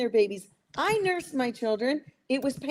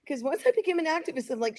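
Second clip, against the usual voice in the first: ordinary talk, nothing marked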